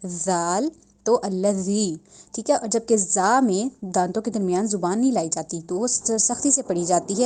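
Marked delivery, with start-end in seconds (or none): 0.1-2.0 الذی with the tongue softly between the teeth; soft ذال. Shown as a demonstration. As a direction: voice the ذ in الذی gently and slowly. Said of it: "ایسے".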